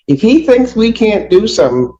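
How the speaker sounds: normal talking voice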